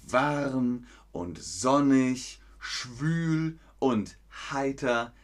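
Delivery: slow, carefully spaced words; stretched-out teaching delivery; slow teacher voice. Shown as a demonstration. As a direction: spell out each weather word slowly for learners. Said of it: "Wann ist es".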